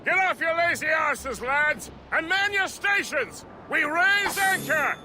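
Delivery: hearty bellowed orders; rousing shout. Acted. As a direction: yell the crew to their stations. Captain rouses crew.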